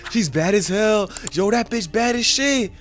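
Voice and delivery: high, gushing onlookers; mock admiring crowd. Onlookers gawking at the girl, mock awe.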